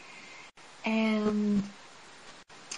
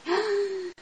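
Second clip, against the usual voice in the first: gasp; 9 semitones higher, 5 dB quieter than usual. Retold or imitated. Panicked gasp in retold scene.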